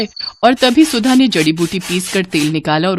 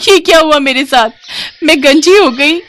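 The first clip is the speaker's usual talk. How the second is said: high, loud cry of dismay; horrified wail; 8 semitones higher, 5 dB louder than usual. Acted. Rival discovers she has gone bald, horrified. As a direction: shriek in theatrical shock at the disaster.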